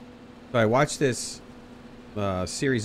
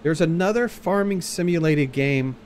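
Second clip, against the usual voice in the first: normal talking voice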